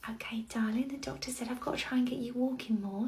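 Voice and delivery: ordinary delivery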